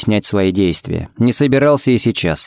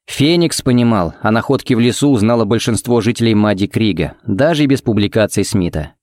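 usual speaking voice

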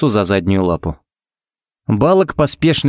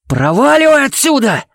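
very high, furious yell; enraged outburst, pitched far up; 3 dB louder than usual. A narrator's reading. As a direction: scream at him to clear off. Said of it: "проорал Кевин".

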